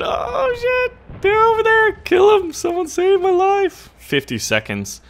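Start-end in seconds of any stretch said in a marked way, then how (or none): 0.0-3.9 very high falsetto wailing; shrill, drawn-out mock panic; panicked shriek. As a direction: squeal for help in hammed-up terror. Mock panic under missile attack.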